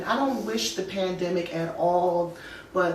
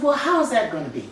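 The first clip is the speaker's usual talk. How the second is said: laughing disbelief; 4 semitones higher, 5 dB louder, much faster than usual. Retold or imitated. Questioning God in disbelief.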